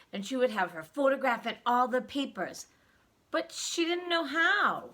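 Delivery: plain reading voice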